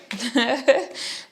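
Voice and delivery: short, high laugh; spontaneous giggle; amused laugh. Real, not acted. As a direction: laugh at your own silliness. Amused at own song outburst.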